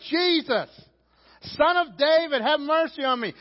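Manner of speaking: high, loud desperate plea; full shout. Acted. Blind man yelling for Jesus' attention, loud high shout. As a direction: shout it out to get his attention. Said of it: "He's shouting".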